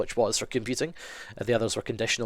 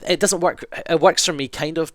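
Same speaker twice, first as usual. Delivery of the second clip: excited; 6 semitones higher, 7 dB louder than usual. Real, not acted. Laughing off her own singing.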